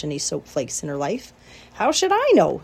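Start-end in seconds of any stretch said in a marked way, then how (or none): 1.7-2.6 high, loud exasperated snap; rat's retort. A narrator's reading. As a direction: Templeton fed up with questions. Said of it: "said Templeton".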